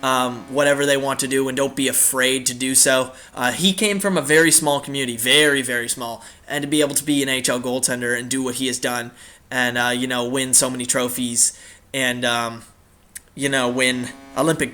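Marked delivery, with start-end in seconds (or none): none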